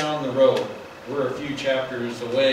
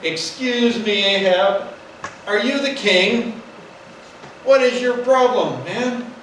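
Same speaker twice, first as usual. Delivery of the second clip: higher, slow scornful taunt; disdainful wife; 6 semitones higher than usual. Retold or imitated. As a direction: sneer the questions down at him. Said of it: "I can just hear the disdain in her voice".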